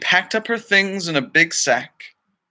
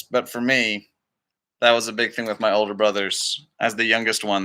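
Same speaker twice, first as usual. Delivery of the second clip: usual speaking voice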